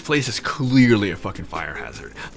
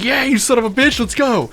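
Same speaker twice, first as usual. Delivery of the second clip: much higher and louder, pumped up; hyped yell; 12 semitones higher, 4 dB louder than usual. Retold or imitated. Nick hyping Lou up to mosh, yelling. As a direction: roar it into the mosh pit.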